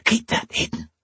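urgent voiceless hiss. A narrator's reading. Woman warning him to hide the letter, hissing. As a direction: whisper it sharply, all breath. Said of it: "she hissed, grabbing for it".

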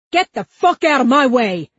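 high, loud, enraged yell; amped-up shout. A narrator's reading. Amped-up cop to Huck, enraged shout. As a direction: yell it at full command force.

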